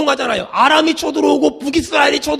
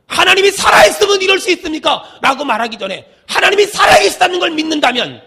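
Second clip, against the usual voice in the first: full-force preaching; 2 semitones higher, 4 dB louder than usual. Real, not acted. Preacher rebuking those who question God, forceful preaching. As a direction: drive the argument home at a shout.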